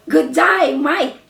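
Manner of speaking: Australian good day mate; playful Australian accent. Shown as a demonstration. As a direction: say it like an Aussie greeting. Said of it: "I know how to say in Australian".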